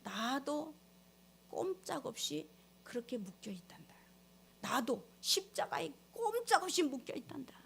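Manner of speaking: hushed, breathless whisper as the voice of God. Acted. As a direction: breathe it like a divine secret. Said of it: "하나님의 음성이 들려요".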